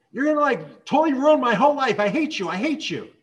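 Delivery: high, very loud retold tantrum; screaming teen. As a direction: explode at mom like an outraged teenager. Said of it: "her daughter exploded, started screaming at her".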